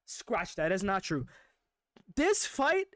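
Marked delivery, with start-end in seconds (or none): 2.1-2.9 excited emphasis. Real, not acted. Hyping the upcoming fight.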